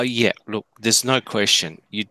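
ordinary talk, nothing marked

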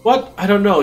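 surprised high yelp; startled. Acted. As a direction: jump at the question. Startled student NPC.

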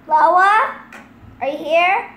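loud, questioning call. Real, not acted. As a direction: call out to check if anyone is there. Calling to check if someone is there.

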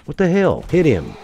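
loud, high exasperated outburst; alarmed shout. Real, not acted. Player missing an enemy in a fight, alarmed shout. Fight going wrong in game.

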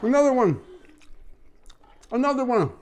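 high loud hype-man shout. Retold or imitated. Shouting like [name].

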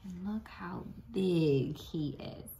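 soft unvoiced whisper. Real, not acted. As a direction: hush it in awe. Whispered aside.

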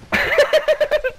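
squealing laugh; high burst of laughter. Real, not acted. Laughs at own joke.

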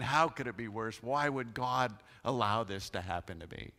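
usual speaking voice

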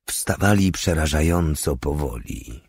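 plain reading voice

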